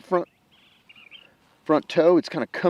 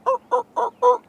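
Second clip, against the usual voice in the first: high yelping hen turkey call; hen yelps; 5 dB louder, faster than usual. Shown as a demonstration. Explaining how hens call the gobblers, high turkey yelps. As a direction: yelp it like a hen turkey calling. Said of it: "So they go".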